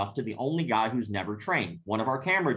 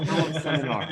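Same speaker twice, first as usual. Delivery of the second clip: laughing mock glee; 2 semitones higher, 4 dB louder than usual. Mocking excited reply to offer.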